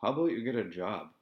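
put-on stern retort to a begging boy; very firm. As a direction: lay down the law to the kid. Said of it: "I said very firmly".

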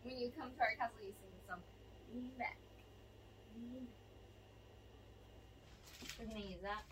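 hushed and low; quiet. Real, not acted. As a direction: murmur it softly. Quiet talk aside.

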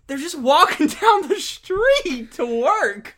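high, slow, drawn-out exasperation; hammed-up high-pitched objection; mock protest. Co-host retelling an argument with Vider Talk people, exasperated. Mocking the complainers' posts.

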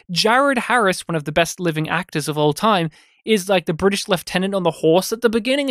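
ordinary talk

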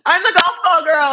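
giggling out her own nickname; laughing. Real, not acted.

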